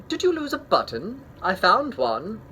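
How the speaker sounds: high, light bird voice; sparrow voice. Acted. Sparrow politely offering Toad a button, high voice. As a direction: chirp it like a small sparrow.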